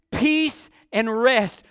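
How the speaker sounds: normal talking voice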